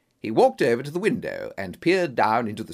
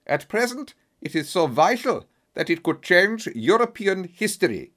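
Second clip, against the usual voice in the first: put-on German accent; 5 semitones higher, 3 dB louder than usual. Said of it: "in a strong German accent".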